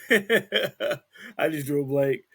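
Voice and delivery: slow, laughing through words. Real, not acted. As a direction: laugh it out while speaking. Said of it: "Mike is laughing at me right now".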